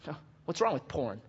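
soft, offhand mock challenge; imitated careless indifference. Unrepentant person questioning the pastor, soft careless tone. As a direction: shrug the question out quietly.

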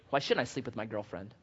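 low, soft, offhand challenge; imitated casual defiance. Unrepentant person questioning the pastor, low offhand defiance. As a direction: ask it quietly, as if nothing matters. Voices an unrepentant, careless churchgoer.

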